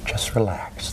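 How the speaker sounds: soothing hushed whisper. Real, not acted. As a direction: whisper it calmly. Hypnotist inducing trance.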